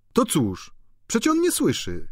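high, brushing-off voice; dismissive landlady. A narrator's reading. Landlady dismissing the worry, shrill. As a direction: wave it away.

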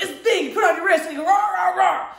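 fast, loud rah rah roar; playful yelling with a gauntlet; mock rah shout. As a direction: roar the rah like a fist pump. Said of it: "I was like".

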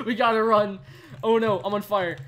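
real frantic shout while still laughing; laughing panic. Real, not acted. Streamer running from fire with his teammate, laughing panic.

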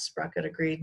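ordinary delivery

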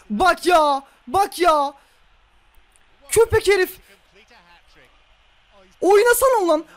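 loud, high threats at the opponent; furious yelling; angry shouting. Real, not acted. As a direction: bark at him to play. Demanding opponent play on.

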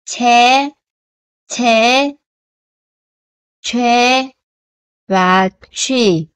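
"che", "chế", "chuê" each set apart and carefully sounded; syllable drill. Shown as a demonstration. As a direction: model each syllable clearly. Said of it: "đọc là chi".

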